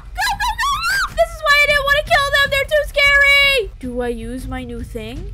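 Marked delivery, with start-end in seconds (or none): 0.0-3.7 high, loud frightened shrieking; panicked scream. Real, not acted. Gamer fleeing a monster, panicked high yelling. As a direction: flee screaming in real panic.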